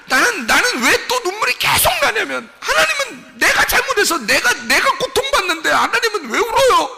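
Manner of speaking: high, loud cry with a broken laugh; tearful outcry. Real, not acted. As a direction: cry it out, half laughing through tears. Preacher cries out in tears. (울음 섞인 높은 외침)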